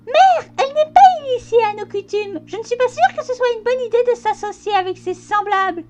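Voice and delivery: mock squeaky colt voice; thin squeaky voice. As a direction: fake a guttural voice and fail into a squeak. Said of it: "une voix de merde fluette".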